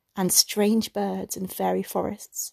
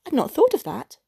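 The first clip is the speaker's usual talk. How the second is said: higher, bright delighted exclaim; joyful realization; 6 semitones higher than usual. A narrator's reading. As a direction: say it as a happy discovery. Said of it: "She uttered a cry of joy".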